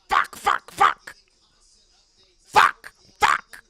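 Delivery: harsh repeated curse in a put-on voice; driver cursing. Driver stuck below the cut line in his car, cursing impression. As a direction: spit each curse out separately, in character. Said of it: "He's making fart faces in the car".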